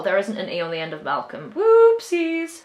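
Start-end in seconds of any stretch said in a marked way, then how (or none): 1.6-2.6 high playful whoopsie; cutesy oops. Real, not acted. Laughs off her spelling slip with a cutesy oops. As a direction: chirp it like a cute mistake.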